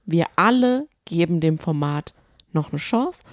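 normal talking voice

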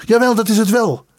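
high-pitched, heated retort; fiery defiance. A narrator's reading. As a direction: stand up to him, passionately protective. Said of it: "vervolgde de student met fonkelende ogen". (fel en strijdlustig)

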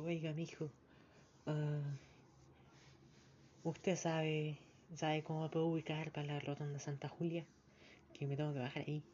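lightly voiced old lady asking the way. Old lady on the bus asks for the rotonda, lightly voiced. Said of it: "una viejita".

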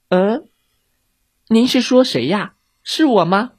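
put-on high, questioning child's voice; innocent little girl. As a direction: ask it like a little girl playing dumb.

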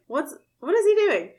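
high, loud mock disbelief; incredulous rewatching the scene; baffled. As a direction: ask it like you can't believe it. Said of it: "I was like".

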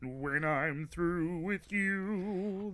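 slow, strained puppet-frog voice; menacing line in a frog puppet voice; Kermit the Frog voice. Acted. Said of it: "so the Kermit the Frog voice".

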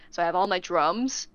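ordinary delivery

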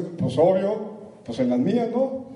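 lazy know-it-all inner voice, drawn out slow; mock the smug little voice in your head; inner squirrel voice. Speaker voices the audience's inner squirrel, smug mock voice. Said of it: "qué les dice la ardilla".

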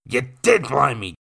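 loud angry growl. Acted. Nathan to William, angry growl. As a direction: snarl it through the pain. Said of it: "Nathan growled".

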